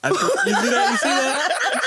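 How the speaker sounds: high, laughing outrage; laughing disbelief. Real, not acted. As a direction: cry out through laughter. Laughing shocked at call girl joke.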